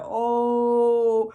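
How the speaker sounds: loud, drawn-out amazed cry; retold freak-out. Recalling freaking out in the parking lot, loud amazed cry. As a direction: hold the oh long and loud. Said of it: "I freaked out in the parking lot".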